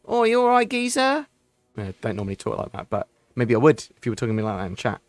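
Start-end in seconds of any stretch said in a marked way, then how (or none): 0.0-1.3 high, loud mock Cockney greeting; hammed-up Cockney geezer hailing a mate; cheeky put-on Cockney, much higher than usual; Cockney impression. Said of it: "part half Cockney".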